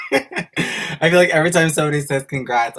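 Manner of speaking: giggling, overwhelmed by the congrats; real laughter over the words; laughing while talking. Real, not acted.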